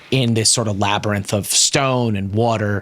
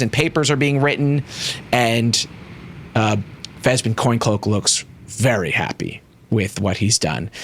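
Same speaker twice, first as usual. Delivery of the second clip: usual speaking voice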